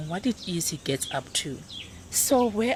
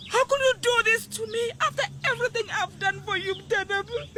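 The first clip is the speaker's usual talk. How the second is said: high, anguished pleading wail; distraught wail; 13 semitones higher than usual. Acted. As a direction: cry out, heartbroken and betrayed. Mother's distraught outburst at son.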